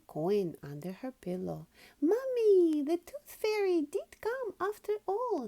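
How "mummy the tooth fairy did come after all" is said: high, loud joyful shout; girl pig thrilled the tooth fairy came. Acted. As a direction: burst out with the news to Mummy. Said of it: "she shouted".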